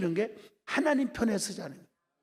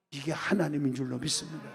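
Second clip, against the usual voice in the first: usual speaking voice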